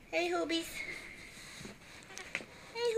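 normal talking voice

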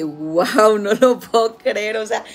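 stunned at what she found; shocked disbelief. Retold or imitated. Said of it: "yo dije".

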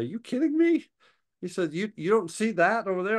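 high, loud disbelieving outburst; incredulous. Retold or imitated. Friend stunned the narrator sees nothing, incredulous. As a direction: act amazed he can't see it.

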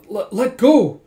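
loud, high stammered demand; acted protest. Girl to drunk who has her by the hands, loud protest. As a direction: play someone struggling to pull free.

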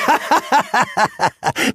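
mocking villain laughter, high then falling; sorcerer's scornful cackle; evil laugh. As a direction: laugh down at him. Rurak laughs at Granok.

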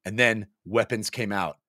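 lively read-aloud narration; story narration. Acted. Reading a funny fantasy story to listeners, lively narration. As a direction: tell the tale with relish.

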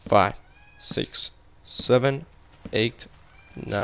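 ordinary delivery

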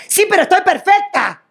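high, fast mock-defiant reply; mock defiant woman. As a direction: toss it back, proudly insisting. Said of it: "te dice". (mujer imitada, desafiante y aguda)

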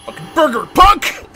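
high, very loud quoted outburst; mock angry shout. Quoting Super Troopers to a friend, mock angry shout. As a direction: yell it like a fed-up movie customer.

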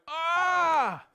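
sharp high cry of pain; pained yelp. Retold or imitated. Little brother crushed under the pile, yelping. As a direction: yelp like your arm just got crushed. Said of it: "I went".

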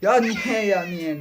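real angry yelling of why. Real, not acted. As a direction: cry out why at the game. Gamer raging at failed level.

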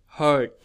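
"Heard" with a long ơ sound; teacher's careful model of "Heard"; long vowel. Shown as a demonstration. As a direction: draw out the vowel in "Heard", falling. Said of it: "Âm ơ dài của chúng ta".

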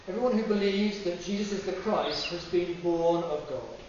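ordinary delivery

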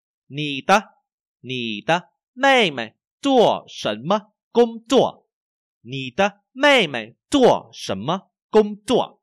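very slow, loud, word by word; language teacher's model reading. Shown as a demonstration. Teacher reads for learners to repeat, slow model reading. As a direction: exaggerate each tone, high and clearly spaced.

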